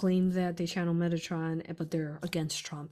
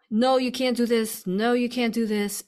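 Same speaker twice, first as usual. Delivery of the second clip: louder, higher imitation of naysayers; mock forbidding; 5 semitones higher, 6 dB louder than usual. Mimicking bullies who tell us what we can't do, forbidding. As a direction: act out someone insistently forbidding it.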